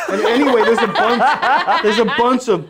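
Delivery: can't stop laughing as you talk. Real, not acted. Streamer laughing to his co-hosts about British accents, laughing loudly.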